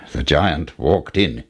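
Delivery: usual speaking voice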